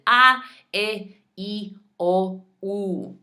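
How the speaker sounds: A, E, I, O, U as big ah, eh, ee, oh, oo, A hit hard; separate, over-articulated A, E, I, O, U; wide, exaggerated vowels. Shown as a demonstration. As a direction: open wide on each of A, E, I, O, U. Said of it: "your mouth is gonna be wide and exaggerated".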